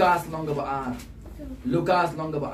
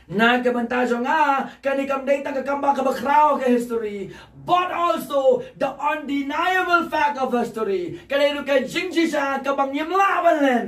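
raised, insistent preacher's pitch; fervent preaching; 9 semitones higher than usual. Real, not acted. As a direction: press the point on the congregation. Preaches at full force.

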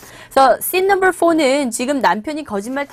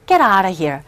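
dismissive; 4 semitones lower, much slower than usual. Shown as a demonstration. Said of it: "억양만 구분하셔서".

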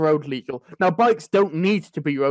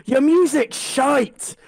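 retold shout; 7 semitones higher, 3 dB louder, much slower than usual. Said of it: "shouted at me over his music".

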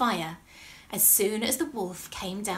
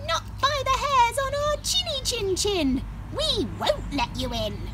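high squeaky pig; little pig voice; 4 dB louder than usual. Acted. Pig answers wolf.

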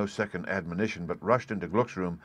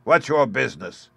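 loud, sharp challenge; snarling at the unwelcome visitor; snarled; 5 semitones higher, 5 dB louder than usual. A narrator's reading. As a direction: snarl it at the old man. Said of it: "snarled Hans".

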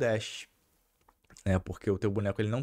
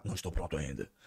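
mock dub line; 9 semitones lower, 5 dB quieter, faster than usual. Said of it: "a entonação da parada muda".

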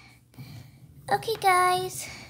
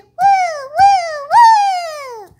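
high, loud whooping; excited whoops; 9 semitones higher, 13 dB louder, much slower than usual. Pretend. Energetic toy whoops to show how excited she is.

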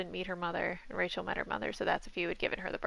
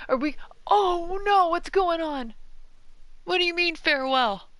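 excited disbelief; 10 semitones higher, 7 dB louder than usual. Real, not acted. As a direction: voice climbing in disbelief. Farewell episode reveal.

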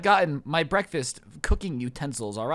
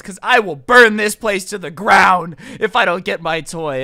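slow, loud mock threat; threatening customer; 9 dB louder, slower than usual. Irate mom threatens the manager over a toy, loud and menacing.

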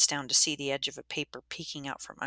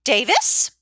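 sharp, much higher, loud question; sharp call; 9 semitones higher, 13 dB louder than usual. Acted. Missus Dwyer catching Davis in the room, sharp. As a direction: snap his name to stop him. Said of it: "The sharpness of the voice".

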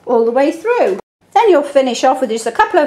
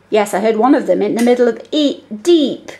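usual speaking voice

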